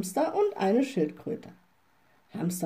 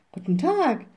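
higher, lightly called greeting; 6 semitones higher than usual. A narrator's reading. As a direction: call the greeting back as the witch. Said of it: "rief die kleine Hexe". (leicht gerufener Gruß, höher)

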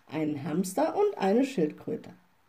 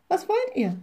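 much higher, lightly called question; 10 semitones higher than usual. A narrator's reading. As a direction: ask it as a light, high call. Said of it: "rief die kleine Hexe". (hoch gerufene Frage)

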